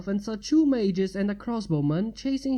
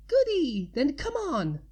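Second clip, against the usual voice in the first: Pinky voice; 7 semitones higher than usual. A narrator's reading. Pinky voice high.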